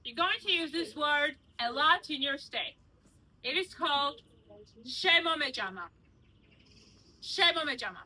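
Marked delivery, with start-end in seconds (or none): 4.9-5.9 "Shemo Mejama" carefully as SHE-mo-me-JA-ma; teaching pronunciation. Shown as a demonstration. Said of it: "I want to teach you one word". 7.2-8.0 "Shemo Mejama" carefully as SHE-mo-me-JA-ma; teaching pronunciation. Shown as a demonstration. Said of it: "Say it".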